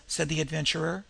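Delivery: plain reading voice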